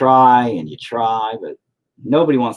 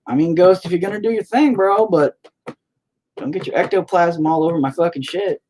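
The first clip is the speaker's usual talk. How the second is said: higher voice, half-joking telling-off to a ghost; scolding the ghost; 9 semitones higher, 3 dB louder than usual. Retold or imitated. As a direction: wag a finger at the ghost, pitched up, bro to bro. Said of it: "I'm just like".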